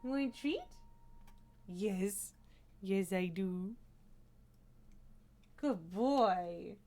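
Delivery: soft, quiet cooing to a pet. Real, not acted. Streamer softly coos to her cat, quiet baby talk.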